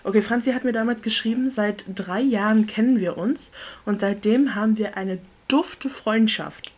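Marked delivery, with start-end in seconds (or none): 5.5-6.7 dufte stretched out slowly; stress on dufte. Shown as a demonstration. Reading an old note aloud, dwelling on dufte. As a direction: linger on the word dufte, drawn out. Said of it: "Betonung liegt auf dufte".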